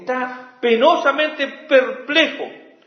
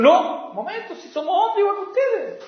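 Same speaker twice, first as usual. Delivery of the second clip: much higher, hurried objection; urgent protest; 12 semitones higher than usual. Retold or imitated. Apostles urgently refusing the crowd's sacrifice. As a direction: stop them before they worship you. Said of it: "a duras penas dijeron".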